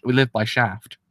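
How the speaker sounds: ordinary talk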